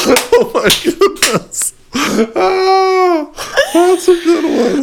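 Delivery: high, squeaky talking through laughter; real cracking up, words stretched high; helpless laughter. Real, not acted. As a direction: barely get it out for laughing. Listener cracks up at the joke.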